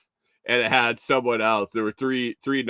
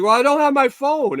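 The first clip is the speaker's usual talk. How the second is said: put-on high, louder fussy old lady; 7 dB louder than usual. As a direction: play her as a flustered older woman. Old lady voiced in story.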